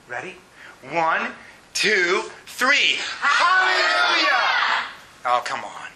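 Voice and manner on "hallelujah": high, long congregational cheer of praise; shout. Real, not acted. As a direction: shout it out together on the count. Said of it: "we're gonna shout hallelujah".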